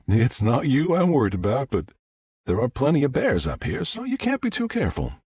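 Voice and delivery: high-pitched, easygoing Southern voice; smooth Southern woman. Acted. Visitor explains her gun to her host, Southern voice.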